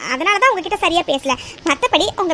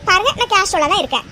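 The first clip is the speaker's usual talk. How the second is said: ordinary delivery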